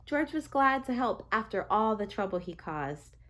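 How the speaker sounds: plain reading voice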